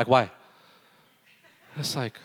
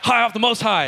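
proud quip; 10 semitones higher, 6 dB louder than usual. Retold or imitated.

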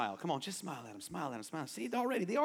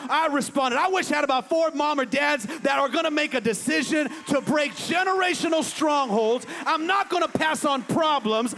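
loud, raised, driving pulpit shout; full-force preaching; 9 semitones higher, 11 dB louder than usual. Real, not acted. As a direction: build a rallying declaration, phrase by phrase.

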